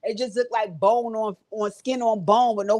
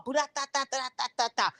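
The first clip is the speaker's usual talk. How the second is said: quick rattling da-da fight noises; punching sound effect; 4 semitones higher, 7 dB quieter than usual. Retold or imitated. Vlogger acting out the fight with punch noises. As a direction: rattle off the punches like a cartoon brawl.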